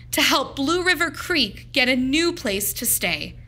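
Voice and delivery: ordinary delivery, nothing marked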